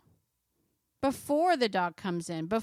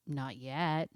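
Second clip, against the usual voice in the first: "Not yet" dropped lower and softer, like an aside; quiet repeat; 7 semitones lower, 6 dB quieter than usual. Shown as a demonstration. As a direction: mutter "Not yet" low under your breath.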